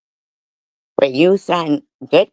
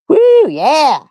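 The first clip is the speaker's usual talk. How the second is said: mock cheer; 14 semitones higher, 7 dB louder than usual. Sarcastic cheer for trolls.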